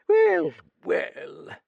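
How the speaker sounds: put-on high drawn-out old lady's well, well; consoling old Duchess. A narrator's reading. Old Duchess consoling the young writer, high and drawn out. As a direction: soothe him like a nosy old aunt. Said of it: "she consoled".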